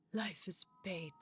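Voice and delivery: slow, low and soft despair; gloomy. Acted. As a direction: sigh it out like a weary old man. Gloomy scholar line.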